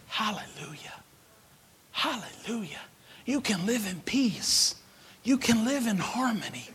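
breathy hushed preaching; soft whisper. Acted. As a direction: drop to a whisper.

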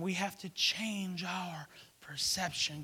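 slow hushed whisper. Acted. Preacher whispering the lesson to the church. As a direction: lean in and whisper it.

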